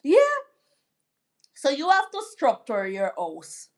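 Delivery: ordinary talk, nothing marked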